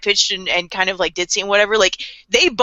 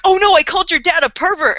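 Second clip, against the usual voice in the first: higher, louder mock shock at her own blunder; mock horrified; 8 semitones higher, 5 dB louder than usual. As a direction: gasp it out like an oops moment. Misa realising her blunder.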